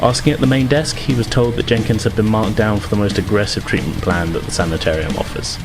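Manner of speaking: ordinary talk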